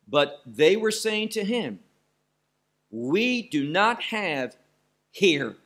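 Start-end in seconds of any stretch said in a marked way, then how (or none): 2.9-5.6 "We do not have" slow, with weight on "not"; slow and heavy on "not" then a firm "here"; emphatic. Shown as a demonstration. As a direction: drag out "We do not have" and land hard on "here". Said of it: "And this is emphatic".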